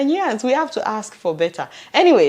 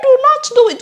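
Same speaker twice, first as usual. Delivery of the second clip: mock warning shout; 8 dB louder than usual. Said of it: "I will say".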